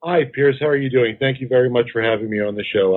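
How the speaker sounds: normal talking voice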